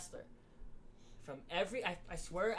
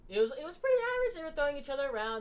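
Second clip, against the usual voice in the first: much higher, louder and fast; a fan gushing about the match; excited rush; 10 semitones higher, 8 dB louder, faster than usual. Real, not acted. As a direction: rattle it off excited. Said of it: "excited recap".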